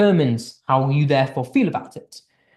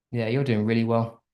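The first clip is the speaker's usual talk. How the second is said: lower, softer, dismissive praise; flat sarcastic praise; 5 semitones lower, 4 dB quieter than usual. Shown as a demonstration. Teacher demoing praise to a student, flat and sarcastic. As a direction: say it like you don't mean it. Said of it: "the tone is very different".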